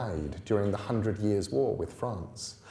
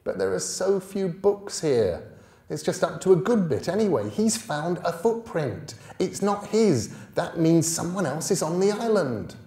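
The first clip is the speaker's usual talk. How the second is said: higher, quick excited pleading; eager boy; 9 semitones higher, 5 dB louder than usual. Acted. Young boy pleading to keep reading. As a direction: sell him on the story. Bod pleading about the book.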